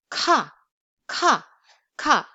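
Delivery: "Ka" with a strong puff of air; strong aspirated k. Shown as a demonstration. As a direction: blast air after the k in "ka". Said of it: "It's stronger".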